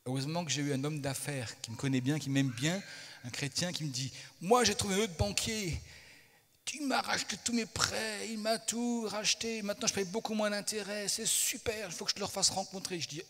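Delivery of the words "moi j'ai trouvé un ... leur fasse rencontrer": higher-pitched, enthusiastic quoted friend; imitated gushing tip, pitched up; excited businessman. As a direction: rave about a great find in a raised voice.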